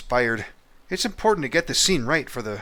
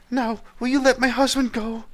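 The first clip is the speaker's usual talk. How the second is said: much higher pleading falsetto; high-pitched wife begging mercy for her husband; 8 dB louder than usual. Acted. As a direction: beg the lord in a high put-on woman's voice. Wife asks king.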